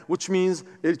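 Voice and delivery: normal talking voice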